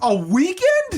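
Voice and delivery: high, loud, drawn-out disbelief; shocked. Real, not acted. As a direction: stretch it out in shock. Shocked at a weekend.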